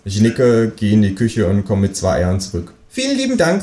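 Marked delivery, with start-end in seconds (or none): 2.9-3.6 very high kangaroo thanks; kangaroo character. Acted. Kangaroo thanks the neighbour for the eggs, very high. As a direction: pitch the thanks right up high. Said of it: "sagt das Känguru".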